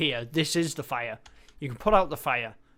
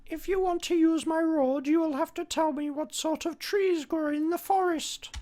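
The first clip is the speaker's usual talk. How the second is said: much higher, odd put-on gatekeeper voice; 14 semitones higher than usual. Gatekeeper demanding an answer before letting the player pass, in a much higher put-on voice. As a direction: play a fussy little gatekeeper guarding his road. Game gatekeeper blocks the road.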